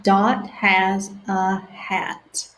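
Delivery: stretched-out sounding out; slow sounding out. Shown as a demonstration. Teacher reading slowly with children. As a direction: read each word slowly for beginners.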